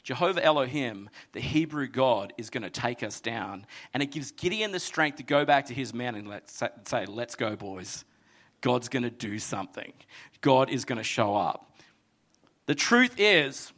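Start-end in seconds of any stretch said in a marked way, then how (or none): 0.0-3.7 lower, soft fearful murmur; fading to a hush; enemy murmuring in fear, fading soft; awed hush. Retold or imitated. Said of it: "whisper and utter the name". 3.9-11.7 much softer, low intense delivery; softly building faith; hushed. Real, not acted. Quiet intimate sermon climax.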